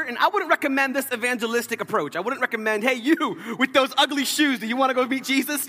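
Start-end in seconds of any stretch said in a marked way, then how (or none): 2.8-5.7 mock street evangelist, pitched up and slowed; brash call-out. Mock evangelist to stranger with ugly shoes, brash call-out. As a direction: hail a stranger with comic bluntness. Acts out crude evangelistic approach.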